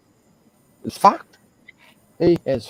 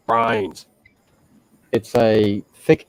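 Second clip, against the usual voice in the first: normal talking voice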